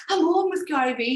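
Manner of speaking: high, eager announcement; telling her husband, high and thrilled; excited. Retold or imitated. Excited call to her husband. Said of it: "saying to Dave".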